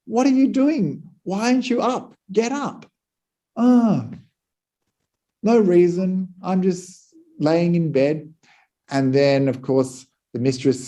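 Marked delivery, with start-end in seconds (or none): 0.1-2.9 high-pitched mock anger at the lazy maid; upset mistress. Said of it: "the mistress is very upset". 3.6-8.3 lightly voiced maid, pitched up and unbothered, slowing at the end; a hint of a lazy girl shrugging it off; cheeky maid. Maid provokes her mistress lazily.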